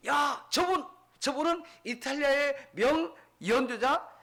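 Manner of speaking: high, loud cry of recognition; excited shout. Acted. Onlooker recognizing the street violinist, excited shout. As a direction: call out loudly to a crowd. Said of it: "큰 소리로".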